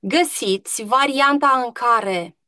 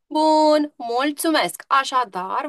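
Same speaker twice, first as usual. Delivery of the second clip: normal talking voice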